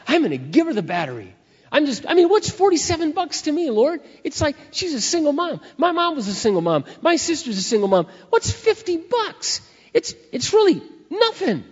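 very high, loud gushing; acted-out excitement climbing into a squeal; excited. Shopper telling the Lord about helping single mom, excited. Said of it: "all of a sudden I'm excited".

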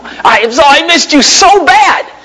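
high, slow swooning wife voice; a lovestruck wife, high and drawn out; gushing wife. Pretend. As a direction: play the wife who missed him terribly. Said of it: "wanted ... Kathy really like crazy to see me".

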